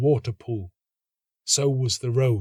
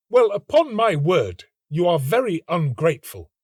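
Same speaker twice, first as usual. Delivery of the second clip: higher, louder indignant outburst; angry; 6 semitones higher, 5 dB louder than usual. A narrator's reading. The student rebuking the ungrateful girl, loud angry voice. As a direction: snap back, offended. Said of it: "said the student angrily".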